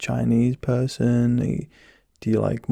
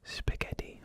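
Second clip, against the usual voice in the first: soft unvoiced whisper into one ear; 13 dB quieter than usual. Shown as a demonstration. Doctor whispering a test word to patient, whispered. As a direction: breathe the word close to the ear. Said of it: "Same again".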